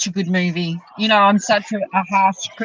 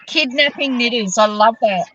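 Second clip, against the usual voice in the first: ordinary talk, nothing marked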